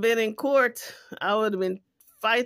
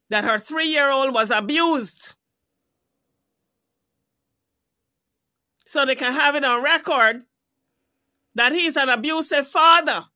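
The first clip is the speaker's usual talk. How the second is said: getting higher and louder toward abusive father; rising outrage; 6 semitones higher, 7 dB louder than usual. Real, not acted. Vlogger furious that the abuse is not on record, rising loud anger. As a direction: build the anger to a peak.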